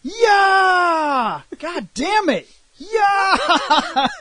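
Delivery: high strained battle cries; hammed-up combat shouting; mock fight yells. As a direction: yell like fighting offscreen. Fight grunts heard offscreen.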